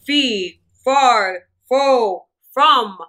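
loud chant, then low slow growl; booming giant. Acted. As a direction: boom it out like a giant. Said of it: "the giant cried".

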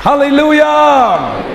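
soaring pulpit preaching; preaching at full force. Acted. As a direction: lift the room into a hallelujah.

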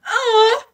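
very high swooning "oh"; delighted high "oh". Acted. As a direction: let out a high, touched "oh". Moved by the love letter.

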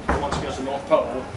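ordinary delivery

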